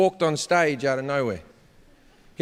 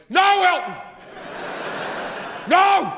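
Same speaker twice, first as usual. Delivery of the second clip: put-on a teenage fan wailing in protest; kid losing his mind; 11 semitones higher than usual. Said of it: "the kid just lost his mind and went".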